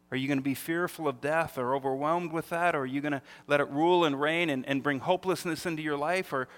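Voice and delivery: ordinary talk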